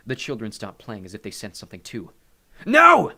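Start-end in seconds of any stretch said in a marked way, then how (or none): 2.7-3.1 very high, loud cry; alarmed shout. A narrator's reading. Hades senses disaster coming, shouting in alarm.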